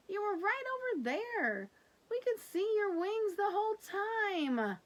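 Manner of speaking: put-on high, loud teasing kid voice; teasing brother. Brother teasing Lulu she was never lost, high and loud. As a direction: tease your sister playfully.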